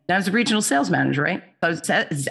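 ordinary talk

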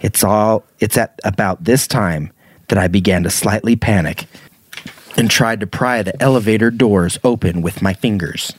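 ordinary talk, nothing marked

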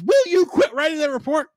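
high, mock exasperated demand. Character nagging a colleague over her report, mock exasperated. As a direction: act out losing patience, pitch jumping up.

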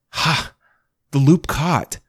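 excited gasp of triumph. A narrator's reading. Triumph as the loop catches.

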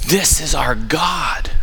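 slow, hushed and reverent; whispered slowly in awe; awed whisper. Real, not acted. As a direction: let it fall to a breath. Awed hush about God.

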